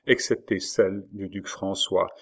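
ordinary delivery, nothing marked

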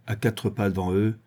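ordinary delivery, nothing marked

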